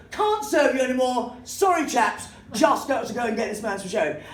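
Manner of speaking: high-pitched, manic mock courtesy; Basil Fawlty. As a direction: excuse yourself in hammed-up, flustered politeness. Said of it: "then I turned into Basil Fawlty".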